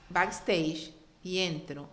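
usual speaking voice

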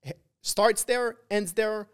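loud, high, fired-up burst; excited. Real, not acted. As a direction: pump the crowd up. Hyped-up closing of podcast point.

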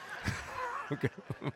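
high burst of real laughter at the joke; laughing. Real, not acted. Pastor laughing at the Forbes joke to congregation.

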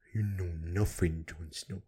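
low, hushed, lightly voiced Ygritte line; a half-hearted go at the famous line; mock whisper of the show quote; Jon Snow quote. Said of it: "the worst impression in the life".

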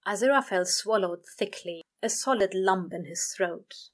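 ordinary delivery

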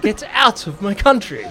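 put-on British, every word separate; refined British accent. Shown as a demonstration. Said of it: "that sounds less refined".

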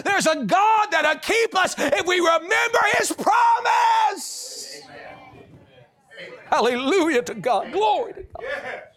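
high, shouted revival preaching; full-force preaching. Real, not acted. Preacher crying no fear to the congregation, shouting preaching. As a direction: build to a drawn-out triumphant shout.

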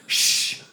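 sharp "shh" imitating a scowling coworker; scowling shush. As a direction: shush them dismissively, with a scowl. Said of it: "he scowls, and he goes".

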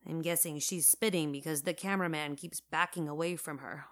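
ordinary delivery

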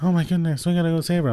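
high mocking voice of a panicked woman. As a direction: ham up a fake worried cry, pitched high. Mocking [name]'s alarm.

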